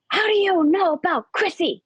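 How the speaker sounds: high, emphatic indignant outburst; mock yell, pitched well up; retold angry yelling. Friend yelling about his dead friend's sister, retold high and indignant. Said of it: "he's, like, yelling at me again".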